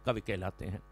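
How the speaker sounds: hushed closing line of the poem; whispered poetic close; soft dramatic refrain. Shown as a demonstration. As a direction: let कवि कहलाते हैं fall away to a near hush. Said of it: "repeated refrain, soft dramatic close".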